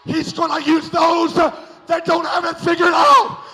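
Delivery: loud, rising preacher's shout; preaching at full force. Acted.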